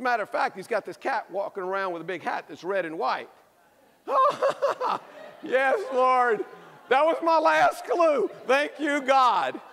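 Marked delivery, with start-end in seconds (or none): 4.1-5.4 big laugh. Real, not acted. Crowd gets the Seuss clue. 5.4-9.6 loud, high praise to heaven; jubilant relief shout. Acted. Mock relief as clue lands.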